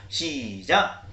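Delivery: ordinary talk, nothing marked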